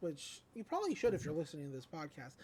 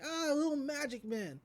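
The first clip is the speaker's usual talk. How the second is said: mild mock shriek; 8 semitones higher than usual. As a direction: imitate a startled shriek, half-hearted. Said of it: "she just shrieks".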